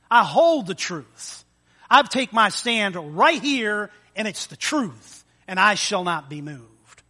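slow and loud, every word planted; firm declaration. Shown as a demonstration. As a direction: stand your ground as you say it. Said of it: "proclaims stand on truth".

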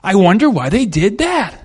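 hammed-up suspicious wondering; suspicious inner voice. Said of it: "your brain starts going".

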